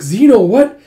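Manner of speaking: high, loud astonished question; startled surprise. Acted. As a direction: react to an unexpected rescuer. Princess startled by rescuer.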